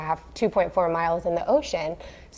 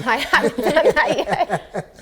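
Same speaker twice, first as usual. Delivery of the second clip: higher, slow words through laughter; laughing admission; 8 semitones higher, slower than usual. Real, not acted. Newlywed admits to the host she's behind on paperwork, laughing. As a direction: say it while laughing. Admitting name change not yet done.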